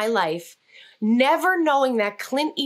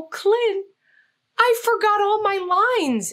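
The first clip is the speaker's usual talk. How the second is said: panicked admission; 11 semitones higher, slower than usual. Retold or imitated. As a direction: blurt it out in alarm. Panicked confession to the director.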